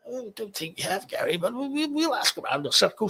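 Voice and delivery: high-pitched mock expat voice; imitating expat. Expat telling Gary they will ask around, imitated high voice. Acting out a genteel expat acquaintance.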